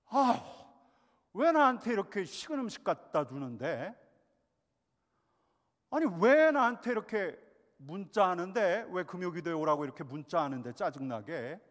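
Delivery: loud, high, whiny griping; irritated complaining. Pretend. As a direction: complain loudly like a fed-up church member. (짜증 섞인 큰 소리로 불평하며)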